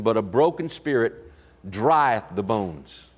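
put-on grave old-scripture cadence; solemn King James reading. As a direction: let it fall away slowly. King James reading, dropping low.

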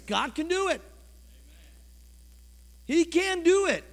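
loud, high, driving preacher's voice, pushing each line harder; preacher pounding the congregation about faith, loud and rising; full-force preaching. Acted.

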